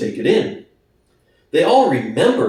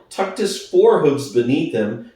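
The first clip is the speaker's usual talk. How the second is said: usual speaking voice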